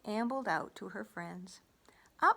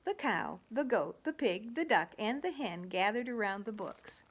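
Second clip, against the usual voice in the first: ordinary delivery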